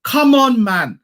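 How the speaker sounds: loud, high come on; exasperated shout. Real, not acted. Fan venting at critics, loud exasperated cry. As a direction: throw your hands up and shout it. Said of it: "I'm emotional".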